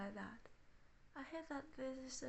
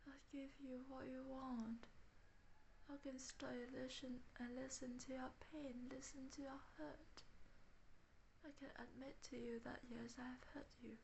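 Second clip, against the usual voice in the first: whispered loving parent; 7 dB quieter than usual. Pretend. Voicing the loving imagined parent.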